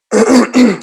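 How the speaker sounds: a quick sharp throat clear. Real, not acted. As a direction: clear your throat before going on. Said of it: "excuse me".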